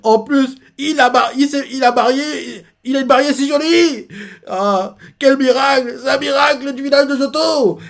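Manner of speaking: crying father overdone for laughs; mock weeping. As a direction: blubber over the miracle bride. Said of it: "il continue à pleurer".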